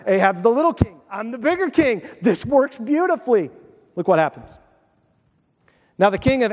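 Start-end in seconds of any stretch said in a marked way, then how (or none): none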